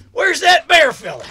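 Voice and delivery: ordinary delivery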